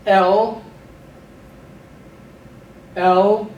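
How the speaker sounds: El called out clearly and loudly; Hebrew vocab drill. Shown as a demonstration. Teacher drills vocabulary aloud for students to repeat, loud model. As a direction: project El for the class to echo. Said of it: "I'll say it, pause for you to repeat it".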